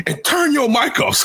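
high, dismissive order; mock dismissive teacher. Teacher ending it with the kid, mock dismissive. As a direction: shut the kid out. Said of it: "he's like".

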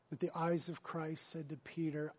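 usual speaking voice

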